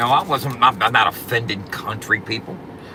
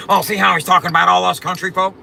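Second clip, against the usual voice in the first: louder, higher mock of the rival; mocking whine; 6 semitones higher, 6 dB louder, faster than usual. Mocking how the rival twists the troll, louder mock voice. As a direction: parody his whining tone. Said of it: "how his stupid ass sounds".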